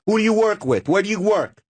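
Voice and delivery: imitating Dice. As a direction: grill the nervous newcomer. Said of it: "points his finger, and goes".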